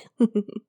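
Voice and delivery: short, sly laugh at the mortal; a succubus chuckling at her summoner's desire; knowing seductive laugh. Acted. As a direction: laugh as if you've seen through him.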